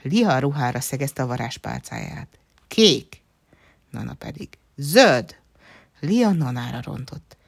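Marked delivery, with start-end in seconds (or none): 2.7-3.2 high, loud one-word command; insistent shout. A narrator's reading. Lia points her wand at the dress, shouting. Two fairies quarrel over colour. 4.8-5.4 loud one-word retort; insistent shout. A narrator's reading. Nana counters Lia's spell on the dress, shouting. Two fairies quarrel over colour.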